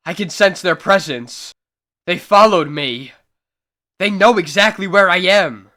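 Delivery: rising dread, glancing over his shoulder; frightened fugitive. Acted. Adam Bentley fleeing unseen pursuers down an alley, frightened.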